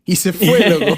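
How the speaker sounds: laughing through the punchline. Real, not acted. Telling a friend how the prophet left, laughing. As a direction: chuckle out the words, amused.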